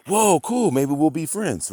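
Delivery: high, loud holy shit and whoa cool; boy learning the new girl lives nearby, excited; kid's excitement. Retold or imitated. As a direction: gush like a thrilled third grader. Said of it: "So I was like".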